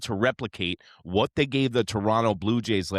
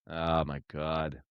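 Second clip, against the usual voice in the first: exasperated sigh; 5 semitones lower, 7 dB quieter, slower than usual. Real, not acted. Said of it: "I get so fired up".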